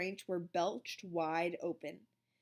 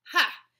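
scoffing ha; 7 semitones higher, 12 dB louder than usual. A narrator's reading. Monitor scoffs.

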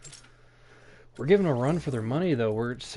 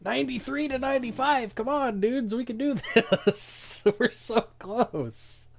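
higher, slow, laughing hope; excited and laughing; 9 semitones higher, slower than usual. Real, not acted. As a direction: cheer the team on through a laugh. Excited near win, laughing.

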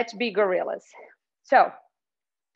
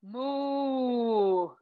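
long, soft imitated moo; cow moo; 6 dB quieter than usual. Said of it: "One more time".

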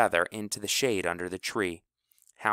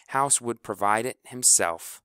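ordinary delivery

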